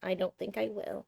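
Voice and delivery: soft, prim refusal on the cat's behalf; voicing the cat. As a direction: speak quietly as the reluctant cat, fading out. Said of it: "She says".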